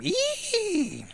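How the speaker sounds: tiny, very high mock shriek; a quick squeaky scream of a little girl; imitated scream. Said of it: "they hear scr-, a scream".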